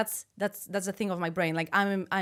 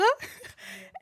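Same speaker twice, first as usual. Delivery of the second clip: high breathy laugh. Real, not acted. Laughs after German order.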